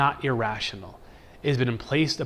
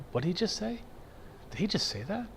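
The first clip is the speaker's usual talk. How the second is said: high, soft, stunned disbelief; can't believe what he just said; a quiet high shocked double take; incredulous disbelief; 6 semitones higher, 8 dB quieter, faster than usual. Retold or imitated. Imagined shocked reaction to coworker.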